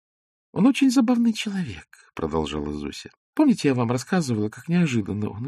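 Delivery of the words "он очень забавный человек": put-on higher, girlish playful voice; lightly voiced young woman. A narrator's reading. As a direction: Zosya teasing Ostap in a girlish voice. Zosya's voice read by narrator.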